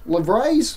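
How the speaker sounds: "livres" as luh-VRAYZ; mock-French guess. Real, not acted. Vlogger unsure of French currency name, "livres" as luh-VRAYZ. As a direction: say "livres" French-style, ending in a rising "rays". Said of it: "however you say that".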